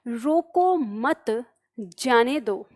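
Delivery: slow "रोको मत", break, then "जाने दो"; group "रोको मत" together, stretched, then let "जाने दो" fall; pause after मत. Shown as a demonstration. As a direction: comma heard after "मत". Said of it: "अपने दिमाग रूपी विराम चिन्ह लगा दें".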